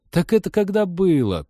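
high-pitched brush-off; dismissive. A narrator's reading. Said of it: "пренебрежительно отмахнулась сестра".

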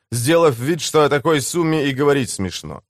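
ordinary delivery, nothing marked

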